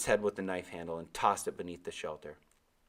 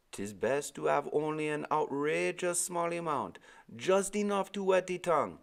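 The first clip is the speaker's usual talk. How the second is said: put-on old Caribbean sailor; 9 semitones higher than usual. Old sailor answering the boy, Caribbean accent. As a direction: give Timothy his lilting Caribbean voice. Timothy speaks.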